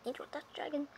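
normal talking voice

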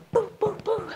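three high-pitched little boops; playful chase noises. Pretend. Coach pretending the class is being chased, high playful noises. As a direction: squeak out running sounds in play.

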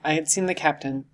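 ordinary delivery, nothing marked

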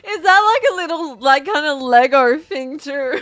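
fighting to talk mid-laugh; real giggling; laughing hard. Real, not acted. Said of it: "can't stop".

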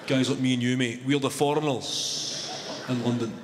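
usual speaking voice

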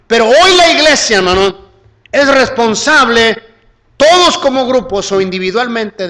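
loud, high-pitched sermon delivery; full-force preaching. Real, not acted. Preacher urges the church to announce the Gospel, full-force preaching. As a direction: proclaim it forcefully to the whole church.

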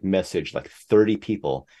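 ordinary talk, nothing marked

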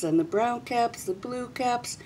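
ordinary delivery, nothing marked

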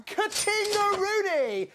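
high, loud and very drawn out; gleeful catchphrase. Pretend. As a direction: crow it like a cash-register jackpot. Celebrating banking the points.

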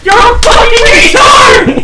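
high slow furious yelling; furious shout. Real, not acted. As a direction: scream at the teammate. Furious at teammate's move.

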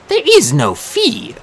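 high and slow, coldly final; eerie Disir voice. A narrator's reading. Disir telling Machiavelli there is no fee, high and slow. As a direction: let it land with chilling calm.

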